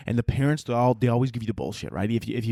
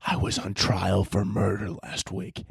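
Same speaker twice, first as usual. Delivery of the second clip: low, slow mock menace; menacing threat; 6 semitones lower, slower than usual. Passenger grabbing a kicking kid's ankle, mock menace.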